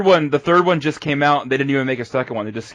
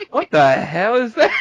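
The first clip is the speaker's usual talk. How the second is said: higher, slow, incredulous; mock baffled; 8 semitones higher, 3 dB louder, slower than usual. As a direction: drag it out like a stunned guest. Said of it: "people come over and they're like".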